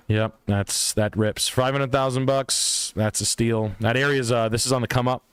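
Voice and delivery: ordinary talk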